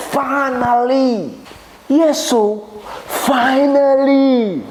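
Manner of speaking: high, long-drawn cry of triumph; triumphant relief. Acted. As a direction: stretch each word high in gloating relief. Gloating over chance to pay back.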